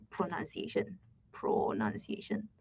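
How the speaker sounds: low murmur sinking into a slow whisper; muttering while searching. Real, not acted. Teacher searching how to pronounce a word, muttering to self. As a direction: sound it out under your breath.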